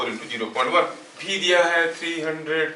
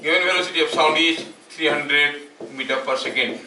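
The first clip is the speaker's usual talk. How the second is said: slow, deliberate dictation pace for note-taking; much slower than usual. Acted. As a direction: read each word out slowly so students can write it down. Dictating a question to students. (धीरे-धीरे लिखवाने वाला अंदाज़)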